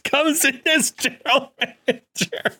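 can barely say it for laughing; laughing while talking. Real, not acted. Podcaster pitching a silly wild card pick, laughing.

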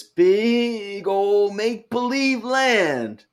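slow, high, drawn-out mockery; mocking. Real, not acted. Co-host ridiculing Arsenal fans' transfer hopes, drawn-out mocking. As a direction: stretch it out to ridicule the fantasy.

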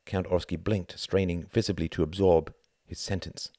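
plain reading voice

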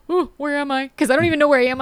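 high, startled little "ooh"; mock surprise at getting lost; a light, high puzzled ooh; surprised. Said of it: "I usually will open my eye and be like".

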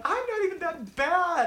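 high, slow indignant protest. Retold or imitated. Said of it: "and I'm like".

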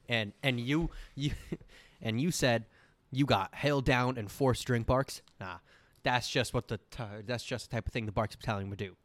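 ordinary talk, nothing marked

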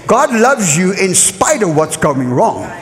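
normal talking voice

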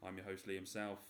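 ordinary talk

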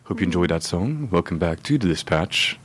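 soothing ASMR host tone; nice radio host voice. Retold or imitated. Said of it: "The nice A S M R voice".

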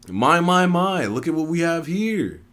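high-pitched, theatrical woman; woman's entrance line. A narrator's reading. Woman surprising the group from behind, high and theatrical. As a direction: draw out a high, showy greeting. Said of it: "a woman said from behind me".

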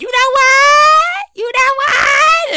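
high, slow taunting bills voice; sing-song mock taunt from the bills; talking bills. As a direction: drag it out like the bills are mocking you. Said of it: "those bills was like".

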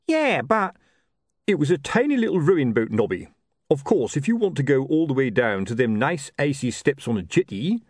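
lightly voiced sergeant arguing; watchmen bickering. Nobby and Colon bickering.

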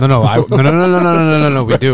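loud rapid string of no; loud protest. Real, not acted. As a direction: cut them off with a flood of no. Emphatic protest to stop topic.

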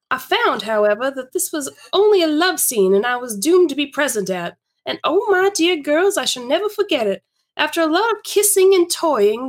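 animated story reading with big pitch swings; lively, theatrical narration; Laura's storytelling. Acted. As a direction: relish the tale for an audience of friends.

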